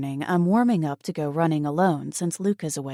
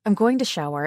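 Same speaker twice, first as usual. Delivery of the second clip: ordinary delivery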